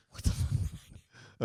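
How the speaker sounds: hushed, breathy panicked aside; panicked inner thought. Retold or imitated. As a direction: mutter it to yourself in a panic. I'm like.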